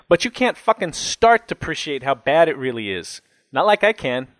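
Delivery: worked-up jailbird driving the point home; riled cellmate, challenging. A narrator's reading. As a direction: set the kid straight, affronted.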